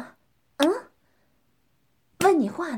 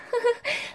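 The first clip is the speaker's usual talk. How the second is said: mocking laugh; 10 semitones higher, 4 dB quieter than usual. Teasing partner in dominant roleplay.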